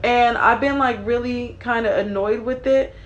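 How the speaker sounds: normal talking voice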